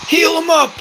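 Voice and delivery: high loud call to heal; urgent shout. Real, not acted. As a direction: yell at the medic. Urging medic in game.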